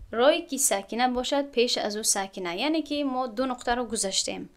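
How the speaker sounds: usual speaking voice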